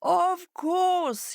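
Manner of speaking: proud fox. A narrator's reading. Fox accepting a kingdom, proudly. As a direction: say it with puffed-up pride. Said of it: "raised his head with pride".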